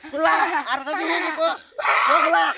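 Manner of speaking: high, loud laughing jeer; laughing taunt. Real, not acted. Mocks the beaten magician, laughing.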